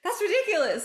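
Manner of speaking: high, loud incredulous squeal; mock outrage, very high and loud. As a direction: shriek it in disbelief. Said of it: "I was like".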